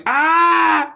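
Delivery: high mock action-hero shout; imitated Tom Cruise yell. Imitating Tom Cruise in trailer, mock yell. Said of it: "Tom Cruise going".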